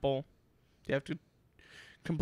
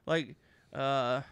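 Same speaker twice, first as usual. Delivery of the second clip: ordinary talk, nothing marked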